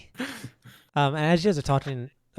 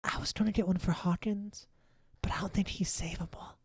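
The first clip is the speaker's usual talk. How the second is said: whispering; 7 semitones higher, 5 dB quieter than usual. Acted. GM whispering an NPC aside.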